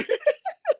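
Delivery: high-pitched giggle; short high giggle; high squealing laugh. Real, not acted.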